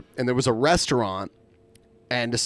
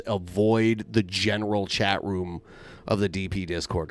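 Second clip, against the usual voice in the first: ordinary talk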